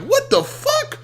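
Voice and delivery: high incredulous yelp; shocked, pitch leaps way up; stunned what the fuck. Retold or imitated. Host recalling the chat blowup, high incredulous yelp. Incredulous reaction to mod snapping.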